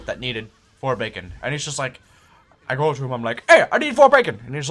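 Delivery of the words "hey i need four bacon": louder, higher call across the kitchen. Retold or imitated. Server calling to the manager for the order, loud and high. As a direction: call it out to be heard.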